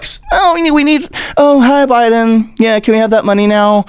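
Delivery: high-pitched fake-sweet asking; mock sheepish pleading. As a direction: put on a high, meek begging voice. Mock Republicans begging Biden for money.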